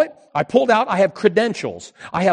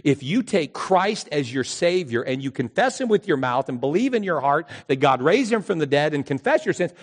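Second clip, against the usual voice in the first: ordinary delivery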